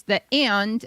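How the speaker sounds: "and" stretched as if two syllables. Shown as a demonstration. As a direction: split "and" into ay-end. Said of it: "two syllables".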